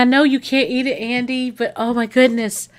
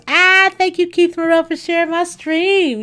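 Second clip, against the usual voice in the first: excited thanks; 9 semitones higher, 4 dB louder than usual. Real, not acted. Thanks a viewer for sharing.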